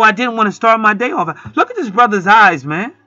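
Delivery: ordinary talk, nothing marked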